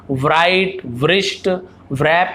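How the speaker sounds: व्राइट, व्रिस्ट, व्रैप with a spoken w; wrong w sound. Shown as a demonstration. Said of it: "ऐसे नहीं पढ़ेंगे". (गलत उच्चारण, व सहित)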